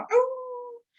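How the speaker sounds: long high dog howl; aullido de perro. Acted. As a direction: howl like a hurting little dog. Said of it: "Porque era un aullido". (aullido agudo y largo de perro adolorido)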